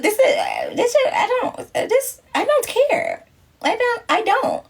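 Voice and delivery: high, drawn-out "don't care"; amused dismissal. Real, not acted. Answering a viewer who dislikes her, mock-helpless and high. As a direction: squeal it, brushing them off. Laughing off a hater's comment.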